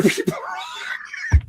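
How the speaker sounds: breaking into high laughter mid-sentence; slow down as the laugh takes over; real laughing. Real, not acted. Host admitting to co-hosts he recommends films, cracking up laughing.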